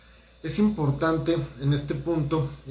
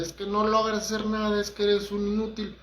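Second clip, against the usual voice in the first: mild mock yell of an angry boss, pitched higher; imitating a yelling boss; 7 semitones higher than usual. Angry boss insulting his employee, a mild mock yell pitched higher. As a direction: lightly play the insulting boss, voice up high. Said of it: "que te grita y que te dice".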